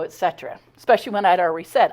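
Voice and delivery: ordinary delivery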